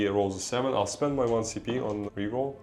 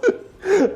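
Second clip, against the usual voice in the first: high laugh; 6 dB louder than usual. Real, not acted. Game dragging on.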